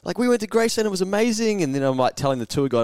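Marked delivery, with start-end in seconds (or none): 0.0-1.6 high, gushing friends; mock gushing. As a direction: rave about it like excited tourists. Friends gushing about Graceland.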